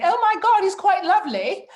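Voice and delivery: high, loud posh British voice; queen impression. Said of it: "queen even saying".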